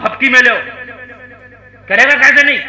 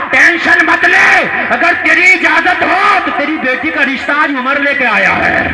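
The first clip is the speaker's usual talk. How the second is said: proclaiming shout; 6 semitones higher, 5 dB louder than usual. Acted. Umar tells the widow he brings the proposal, loud. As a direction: boom out the promise.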